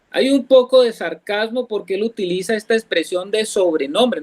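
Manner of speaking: normal talking voice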